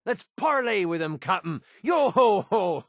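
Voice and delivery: high, hammed-up pirate voice; mock pirate. Mock pirate talk in a game.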